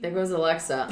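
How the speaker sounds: ordinary delivery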